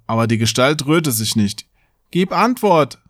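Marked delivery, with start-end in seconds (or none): 2.1-3.0 higher, mildly called demand; calling out. A narrator's reading. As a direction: demand an answer in a raised voice, not a full shout. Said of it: "rief der Junge". (fordernd gerufen, ohne zu schreien)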